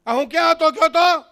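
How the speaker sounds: high, loud anxious-sounding call; hammed-up shouted question; husband on speakerphone. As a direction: call out loudly into the phone. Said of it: "તો કિંજલભાઈને સ્પીકરમાં phone કરે".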